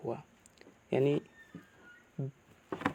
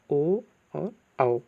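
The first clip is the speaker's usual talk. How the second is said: slow vowel listing; much slower than usual. Shown as a demonstration. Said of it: "इनकी संख्या कितनी होती है".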